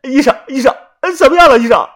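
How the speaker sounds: high, loud anxious calling; anxious pleading. A narrator's reading. Yuanbao running to the doctor, high and loud with anxiety. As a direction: rush up begging for news. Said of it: "元宝忙跑过去问道".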